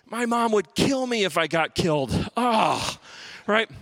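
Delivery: normal talking voice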